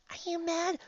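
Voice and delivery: high, soft anxious question; soft high worried question; timid worry. Real, not acted. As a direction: ask it meekly.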